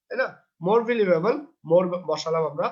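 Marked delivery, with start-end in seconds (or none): none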